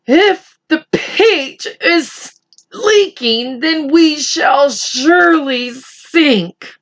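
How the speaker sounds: high, slow worried worm voice; fretful earthworm. Acted. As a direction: moan like a gloomy worm.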